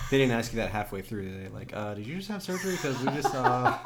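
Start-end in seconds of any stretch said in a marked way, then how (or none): 1.8-3.8 laughing, high and loud; laughing mock question. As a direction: giggle through the quoted question. Voicing the study people asking.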